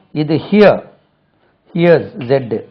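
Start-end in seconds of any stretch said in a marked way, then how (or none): none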